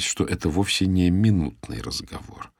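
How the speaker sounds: plain reading voice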